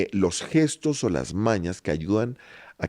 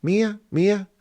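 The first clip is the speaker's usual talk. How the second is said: the word mía sung much higher; high-pitched demo; 11 semitones higher than usual. Shown as a demonstration. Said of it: "Es distinto si tú dices mía". (mía en tono alto)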